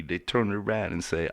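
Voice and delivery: ordinary delivery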